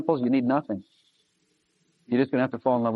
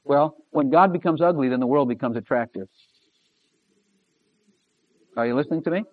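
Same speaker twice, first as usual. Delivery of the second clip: normal talking voice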